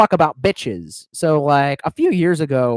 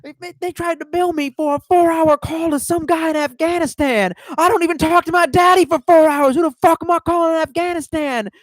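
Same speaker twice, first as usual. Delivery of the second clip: much higher, animated comic rant; higher pitched impersonation of an outraged comedian; Chris Rock impression; 13 semitones higher than usual. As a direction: rant like a stand-up about a phone bill. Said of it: "and Chris Rock's like".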